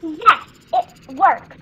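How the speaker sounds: loud, slow, punched 'yes'; emphatic cheer of success; triumphant exclamation. Acted. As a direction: celebrate it, hitting each word hard. Telescope transport has succeeded.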